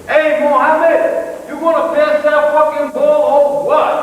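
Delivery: put-on high, loud street-kid holler; yelling schoolyard teenager. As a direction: holler for the ball across a schoolyard. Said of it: "your son in the schoolyard is gonna be sixteen".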